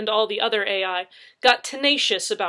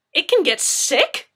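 1 shocked; 9 semitones higher, 10 dB louder, slower than usual. A narrator's reading. Officer's shocked question.

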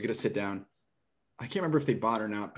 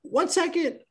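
high call from inside; woman calling back; 5 dB louder, slower than usual. Retold or imitated. Homeowner calling to the salesman to wait a second. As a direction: call back from the next room. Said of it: "she goes".

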